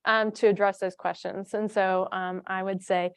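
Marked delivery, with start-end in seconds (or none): none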